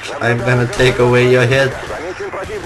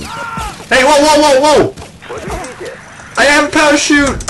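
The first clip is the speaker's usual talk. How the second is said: surprised exclaim; 13 semitones higher than usual. Real, not acted. Gamer discovering he has a parachute, surprised shout. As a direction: react as if saved by a miracle.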